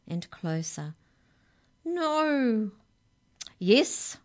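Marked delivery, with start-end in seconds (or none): 1.8-2.7 high cry of horror; horrified cry. A narrator's reading. Giant mesmerised with horror cries out. 3.6-4.2 loud, firm, higher insistence; steely determination. A narrator's reading. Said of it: "once again all steel and determination".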